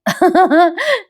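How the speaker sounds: high short laugh; amused giggle. Real, not acted. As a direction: giggle at the hasty correction. निवेदिका स्वतः हसते. (उंच, छोटं हसू)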